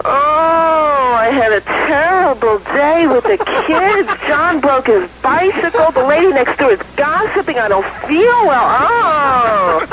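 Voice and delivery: high, slow, drawn-out whiny moan; moaning complaint. Acted. Wife moaning about her day in bed, whiny. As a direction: moan and groan like a hammed-up complainer. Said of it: "now, please, moan".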